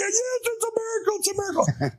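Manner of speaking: high, far-off squeal of joy; mock ecstatic exclamation; overjoyed cry. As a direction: cry it out like you've seen a miracle. Retold joyful cry to flight attendant.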